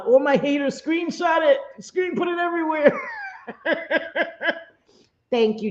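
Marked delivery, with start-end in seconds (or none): 0.1-2.9 high-pitched, giddy taunt; mock dare to haters. Mockingly invites haters to screenshot. 3.0-4.6 high, soft run of giggles. Real, not acted. Laughs after taunting haters.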